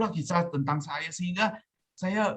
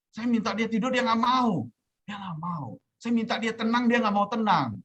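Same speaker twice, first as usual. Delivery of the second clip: higher-pitched, exasperated complaint; retold exasperation; 8 semitones higher than usual. Sleepless man complaining his body will not obey, retold exasperation. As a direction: recount it like a helpless complaint, pitch lifted.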